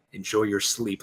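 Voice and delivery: low, soft murmur to a victim; understated sinister hush; imitated whisper. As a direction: say it quietly and low, like a parting word. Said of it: "he whispers to the guards as he kills them".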